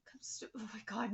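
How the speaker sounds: breathless whisper, fast; stunned hushed aside; shocked whisper. Real, not acted. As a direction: gasp it under your breath. Shock breaks off the reading.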